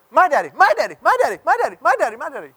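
put-on two little boys fighting over daddy, squealing; kids squabbling. Pastor imitating his sons fighting over his lap. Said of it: "And then it turned into".

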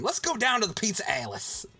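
high, exaggerated Baltimore vowels; hammed-up Baltimore local; mock Baltimore accent. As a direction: call it out in a silly Baltimore accent. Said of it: "that's what we refer to it as".

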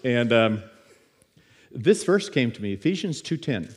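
normal talking voice

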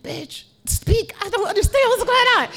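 high, loud, fast mock exasperation; exasperated yell. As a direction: snap at her in frustration. Retold outburst at coworker.

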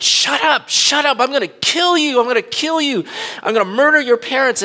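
high, loud mock yelling of threats; retold furious yell. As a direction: shout down the singing boy, retold. Carjacker yells at singing child.

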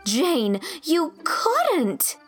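high, loud horrified disbelief; exclaim, scandalized; shocked cry. A narrator's reading. Young teacher appalled at her friend, shocked. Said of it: "cried Anne, shocked".

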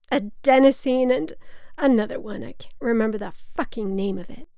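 put-on lightly slurred drunk mother, starting loud and high; a hint of drunken exasperation. A narrator's reading. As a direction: fumble tipsily for the word, protesting. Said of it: "my mother slurred".

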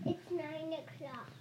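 off-mic, distant and faint; speaking away from the microphone; turned away from mic. Real, not acted. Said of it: "Talk into the mic if you're gonna talk".

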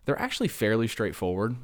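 normal talking voice

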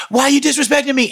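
high, fast mock complaint; rapid high-pitched retold outburst; agitated protest. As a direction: imitate an aggrieved high man flaring up. Imitates high man's agitated protest.

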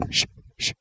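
breathy unvoiced shushing; hushed shushing. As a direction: shush them hard, hissed. Shushing the rival actor.

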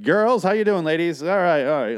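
high, loud, cheesy hey-ladies greeting; chummy manager greeting. Retold or imitated.

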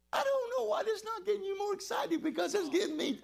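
high, laughing through the words; overflowing happy laugh; laughing joy. Real, not acted. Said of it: "I'm a happy man right now".

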